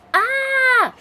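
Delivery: high, loud "Ah!"; performed scream. Acted. Announcer reading a horror anthology's title, screaming. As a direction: belt out a short mock horror scream.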